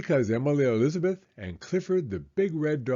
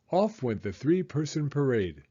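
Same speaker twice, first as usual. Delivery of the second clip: usual speaking voice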